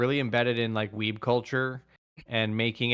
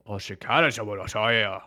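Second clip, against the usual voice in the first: fake Japanese. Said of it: "this is Japanese".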